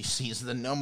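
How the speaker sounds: ordinary delivery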